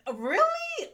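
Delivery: incredulous. Real, not acted. Incredulous outburst.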